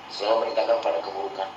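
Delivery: quick hushed echo of the point; quick soft repeat. Shown as a demonstration.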